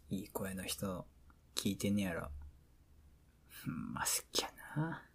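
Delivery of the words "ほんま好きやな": whispering. Acted. Boyfriend whispering at bedtime.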